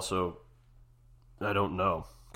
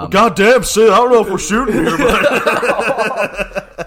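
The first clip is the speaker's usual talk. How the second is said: much higher, much louder put-on old man; old wrestling host; 14 semitones higher, 17 dB louder, much slower than usual. Imitating a wrestling legend grilling a guest, hammed up. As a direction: ham up a cranky old interviewer.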